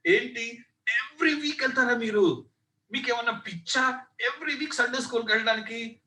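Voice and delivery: high, scornful teasing; bully jeering at the churchgoing kids; mocking sneer. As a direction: ridicule them for going every week. Bully mocks Sunday school kids.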